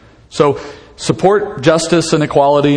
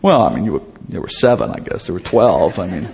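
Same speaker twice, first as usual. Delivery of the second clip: low, hesitant sheepish mumble; 10 semitones lower, 3 dB quieter than usual. Retold or imitated. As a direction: fumble the answer like a caught-out disciple. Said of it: "I kind of answered in a sheepish voice".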